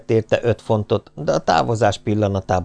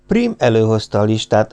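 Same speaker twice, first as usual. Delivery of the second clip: ordinary delivery, nothing marked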